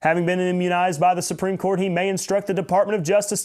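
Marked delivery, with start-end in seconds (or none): none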